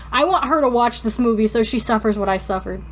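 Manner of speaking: normal talking voice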